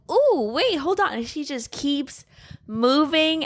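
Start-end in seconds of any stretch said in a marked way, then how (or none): 0.1-1.1 high teasing "ooh, wait"; mock surprise. Retelling Sasha's reaction, high playful mock surprise. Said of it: "And she's just like".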